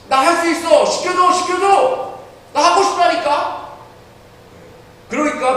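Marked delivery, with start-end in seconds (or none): 0.1-3.8 put-on high, loud childish insisting; insistent child. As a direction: demand the part like a stubborn boy. Ralph insisting on a part. (높고 크게 떼쓰는 아이 목소리)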